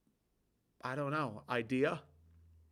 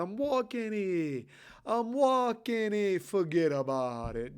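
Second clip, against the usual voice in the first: high, drawn-out New York accent; indignant mock New Yorker accent, stretched out; 6 semitones higher, 3 dB louder than usual. Shown as a demonstration. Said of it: "New York".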